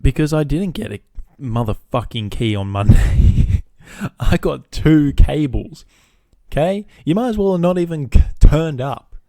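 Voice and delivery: loud, high exasperated outburst; pissed-off rant. Retold or imitated. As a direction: rant indignantly, hammering the key words. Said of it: "Now I'm getting pissed".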